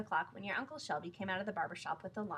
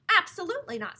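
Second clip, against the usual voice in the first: higher, louder sniffy no; indignant refusal; 10 semitones higher, 12 dB louder than usual. A narrator's reading. Tailor's assistant refusing to make a lion's suit. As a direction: refuse with affront.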